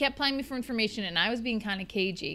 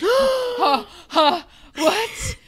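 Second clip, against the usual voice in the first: high gasping mock panic; shocked panic; 13 semitones higher, 6 dB louder, much slower than usual. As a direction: caught off guard, flustered.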